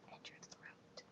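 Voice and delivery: voiceless whispered end of a list. Retold or imitated. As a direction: drop right down to a whisper. Dad's list trails into whisper.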